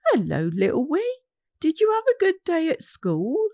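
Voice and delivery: kindly Nana. Acted. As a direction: greet him like a fond grandmother.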